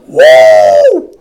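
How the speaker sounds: very high, loud whooping yell; hyped whoop. Retold or imitated. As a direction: whoop at full voice, imitating a pumped-up player. Re-enacting hyped reaction to battle cry.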